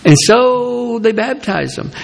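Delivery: ordinary delivery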